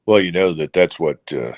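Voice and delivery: usual speaking voice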